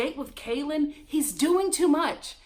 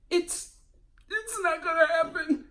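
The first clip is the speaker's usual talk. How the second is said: higher-pitched imitation of crying; mock tearful; 5 semitones higher than usual. Host imitating Katie's breakdown at the end, mock tearful. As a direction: imitate someone breaking down mid-sentence. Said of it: "she starts crying. He has this inaudible breakdown".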